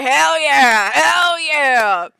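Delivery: loud, high whooping cheer; mock cheer. Speaker mockingly cheering about religions that allow anything, loud. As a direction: shout it like a rowdy fan.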